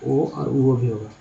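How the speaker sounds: normal talking voice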